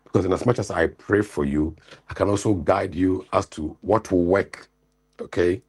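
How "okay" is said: ordinary talk, nothing marked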